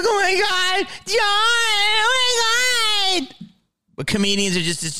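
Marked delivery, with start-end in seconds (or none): 0.0-3.4 high, slow, crying wail; mock sobbing fan. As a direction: gush and whimper like a worried fan. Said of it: "he'd be like".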